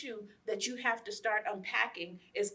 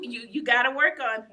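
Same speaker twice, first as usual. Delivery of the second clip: ordinary delivery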